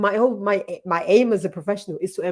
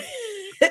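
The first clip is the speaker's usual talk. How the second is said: high laugh; 13 semitones higher than usual. Real, not acted. Laughing at own joke.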